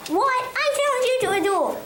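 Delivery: high squeaky outraged fruit, fast; indignant apricot. Acted. Offended apricot squeaks a fast challenge at Rock.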